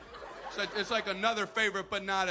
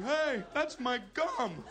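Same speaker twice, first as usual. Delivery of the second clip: high, drawn-out complaint; whiny protest; 6 semitones higher, much slower than usual. Acted. Kid batter objects to infielders in his pockets, whiny protest. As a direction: protest it higher and slower.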